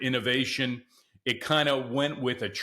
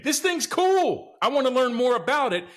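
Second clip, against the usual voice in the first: squealing with delight; excited; 11 semitones higher, 4 dB louder than usual. Real, not acted. Excited about the van.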